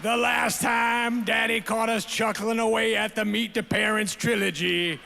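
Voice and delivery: hammed-up Southern speechifying; goofy Southern accent. Dad reads his daughters' speech to the awards crowd, goofy Southern accent. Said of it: "goofy Southern accent you always do".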